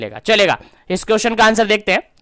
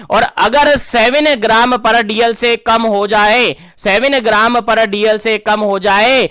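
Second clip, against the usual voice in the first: slow emphatic repeat; slower than usual. Shown as a demonstration. Teacher repeats the key figure slowly.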